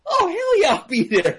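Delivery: high, loud whoop of a yes; gung-ho agreement. Retold or imitated. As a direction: imitate a friend jumping at the chance. Acts friend's eager yes.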